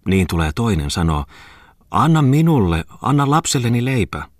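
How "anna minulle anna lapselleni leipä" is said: high, loud, insistent plea; acted beggar pressing her demand; begging woman. A narrator's reading. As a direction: push the repeated plea up high. Second beggar demands bread.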